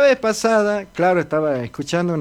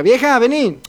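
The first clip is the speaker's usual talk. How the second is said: a lightly voiced old man calling his wife from bed; 6 semitones higher, 4 dB louder than usual. Old husband calls his wife to bed, coaxing. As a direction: coax her over, a bit higher and louder.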